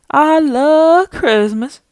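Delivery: very slow, high, stretched-out voice; talking Christmas tree. Pretend. Mocked Christmas tree declaring itself, slow and drawn-out. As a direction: draw the words out high and goofy. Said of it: "the tree looks like it's going".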